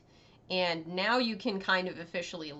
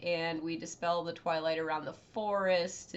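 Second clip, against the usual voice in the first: normal talking voice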